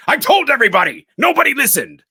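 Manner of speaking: furious high-pitched shout; loud, exasperated acted outburst; Loomis's angry yell. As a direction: yell it at full force, fast and high. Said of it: "angrily, he yells".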